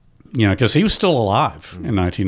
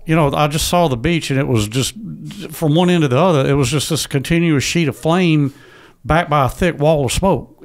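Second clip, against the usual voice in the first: son voicing his late father, pitched higher; imitating his dad; 7 semitones higher than usual. Son echoing his veteran father's interview words, higher-pitched imitation. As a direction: recall Dad's telling with his voice raised. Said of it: "I'm hearing him go".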